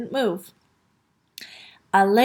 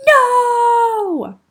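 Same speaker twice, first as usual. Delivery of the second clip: high, loud, long-held yell; 10 semitones higher, 7 dB louder than usual. A narrator's reading. Father and children refusing the cashier, yelling. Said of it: "all yelled".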